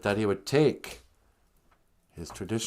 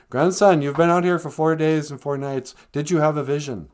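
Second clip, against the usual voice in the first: loud, higher elder's call; mock grandfather; 6 semitones higher, 7 dB louder than usual. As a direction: boom it out like a wise elder calling. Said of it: "his grandfather comes back out and goes".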